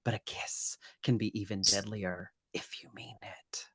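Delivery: low, very soft, fading into a whisper; hushed movie quote. Retold or imitated. Quoting the film's mistletoe line to cohost, hushed and whispery. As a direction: quote it intimately, dropping to a whisper.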